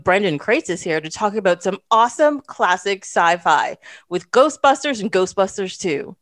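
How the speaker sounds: ordinary delivery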